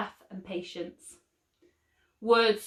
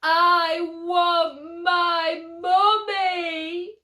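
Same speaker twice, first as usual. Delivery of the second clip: put-on high, louder, slow drawn-out baby wail; 8 semitones higher, 6 dB louder, much slower than usual. A narrator's reading. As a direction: wail it like a homesick little child. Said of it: "wailed Baby Brains".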